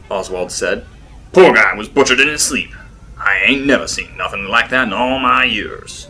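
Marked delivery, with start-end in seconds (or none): 1.3-6.1 louder, lightly put-on voice of a fat publisher; a hint of a blustery old businessman; voiced fat publisher. Fat publisher telling the men about the murder, louder put-on voice. Oswald voiced at lunch table.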